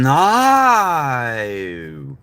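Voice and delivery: very long "no" swooping up and down through several vowels; exaggerated Aussie "no", sliding from "nah" through "eye" to "oh"; British-Australian "no". Shown as a demonstration. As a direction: fit an "I" sound inside a drawn-out "no". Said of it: "You even fit the I in there somehow".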